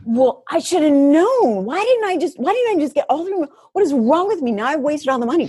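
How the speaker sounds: berating herself over the expired massages; self-scolding. Retold or imitated. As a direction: scold yourself for wasting money. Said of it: "walking around just storming".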